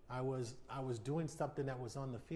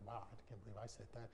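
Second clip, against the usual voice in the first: softer, fast, half to himself; quiet, quick disbelief; 3 semitones lower, 11 dB quieter, faster than usual. Retold or imitated. Recalling old remarks, a quick soft note of disbelief. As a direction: quietly wince at his own words. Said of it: "I was like".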